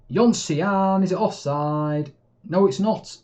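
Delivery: rising, loud excited call of the chance; excited yelling at the screen; excited shout. Real, not acted. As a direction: cheer the striker on, hoping it stands. Excited chance, offside check.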